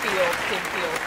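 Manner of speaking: ordinary talk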